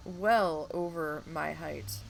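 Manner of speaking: usual speaking voice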